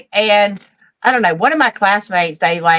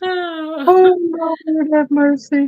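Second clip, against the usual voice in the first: exasperated groan; 7 semitones higher, much slower than usual. Real, not acted. Exasperated reaction to climax joke.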